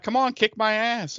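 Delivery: mock challenge, tossed out in retelling; retold taunt. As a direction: egg him on to fight. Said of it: "she starts to taunt him".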